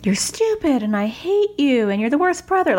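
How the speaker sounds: high-pitched imitation of a screaming child; hammed-up little-sister tantrum, pitched high; mock scream. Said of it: "she will just scream at him like".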